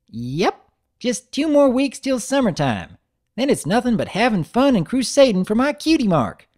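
put-on high, loud, gushing little-girl voice; little sister thrilled about summer vacation; excited filly voice. Narrator voices Apple Bloom.

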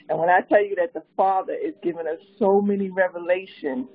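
ordinary talk

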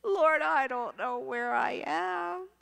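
retold tearful plea, drawn out and high; crying prayer. Stranded teacher prays to the Lord while lost, tearful and pleading. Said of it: "And I'm crying, going".

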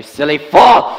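angry outburst. Acted. Renfield snaps at the doctor.

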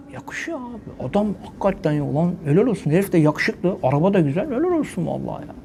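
hushed, awed imitation of fans; mock admiring murmur. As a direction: mutter it like an impressed onlooker. People admiring a footballer's car.

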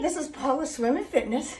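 ordinary talk, nothing marked

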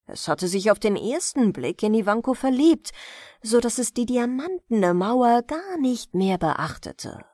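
usual speaking voice

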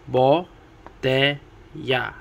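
Bo, te, ya as separate beats with pauses; Bo, te, ya broken apart; split into syllables. Shown as a demonstration. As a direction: say Bo, te, ya one syllable at a time. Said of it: "each segment that is broken up".

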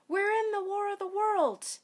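high, loud alarmed announcement; urgent shout. A narrator's reading. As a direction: shout it over the din of battle. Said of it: "she shouted over the roar of the invasion".

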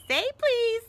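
high, loud, teasing lilt; annoying sing-song. A narrator's reading. Peeves toying with Filch, high sing-song tease. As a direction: taunt in a piping imp voice. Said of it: "annoying sing-song voice".

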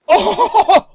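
high, loud amazed "oh"; excited whoop. Real, not acted. Gamer reacts to a rare find, excited whoop. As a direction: burst out in astonishment.

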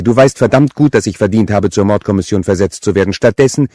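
loud, high, heated complaint; exasperated tirade at full volume; angry cursing. A narrator's reading. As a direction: rant at your brother, swearing. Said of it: "fluchte sie".

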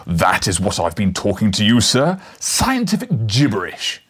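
loud, furious, contemptuous tirade; raging professor. A narrator's reading. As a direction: hammer him with scorn. Challenger raging at impostor.

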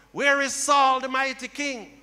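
usual speaking voice

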